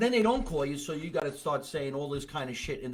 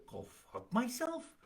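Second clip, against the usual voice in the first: high, soft offended question; incredulous, higher and quieter; taken aback; 8 semitones higher, 9 dB quieter than usual. Shown as a demonstration. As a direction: echo it back in disbelief, voice rising. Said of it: "you might hear".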